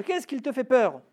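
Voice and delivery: plain reading voice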